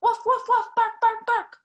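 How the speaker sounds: high loud dog barks; barking dog. Acted. Reading to children, the dog barking loudly. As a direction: bark it out like the dog. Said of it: "And then the dog went".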